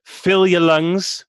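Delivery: slow, full-chested announcer delivery; booming announcer. Shown as a demonstration. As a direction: project it from the chest. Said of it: "And then the most important thing".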